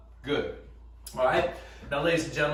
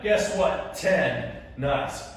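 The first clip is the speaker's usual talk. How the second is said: ordinary talk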